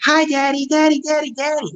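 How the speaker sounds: put-on high excited toddler voice; small child. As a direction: squeal it like a little kid running up. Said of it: "he just bursts in and runs up and goes".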